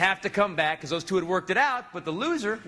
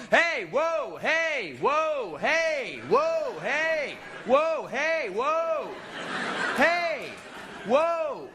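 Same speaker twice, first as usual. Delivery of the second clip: high, repeated calls to separate; cops breaking up a fight; 7 semitones higher, much slower than usual. Retold or imitated. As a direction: imitate officers calling for calm, each word arched.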